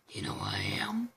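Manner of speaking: very low, rasping whisper; quick, dark creature rasp; creepy symbiote voice. Retold or imitated. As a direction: menace in a monster's hushed voice. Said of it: "with the creepy voice".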